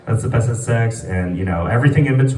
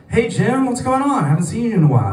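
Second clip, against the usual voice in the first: high cheery greeting; 10 semitones higher, faster than usual. Retold or imitated. Villagers greeting Jim.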